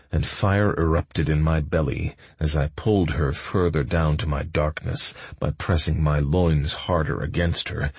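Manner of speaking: plain reading voice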